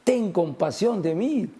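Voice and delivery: slow, high retold cry for mercy; Bartimeo. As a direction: draw out a half-shouted plea. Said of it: "a gritar".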